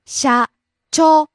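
社長 split into 社 and 長, rising on 長; syllable by syllable. Shown as a demonstration. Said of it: "Rising on the second syllable".

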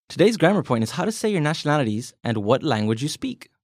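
ordinary talk, nothing marked

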